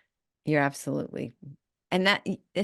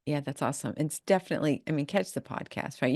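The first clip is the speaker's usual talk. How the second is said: ordinary talk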